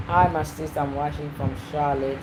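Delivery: usual speaking voice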